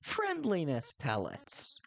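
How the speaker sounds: high, climbing cartoon irritation; hammed-up high-pitched annoyance; exasperated talking flower. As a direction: squeak with rising impatience, then catch yourself. Flowey losing patience, read aloud.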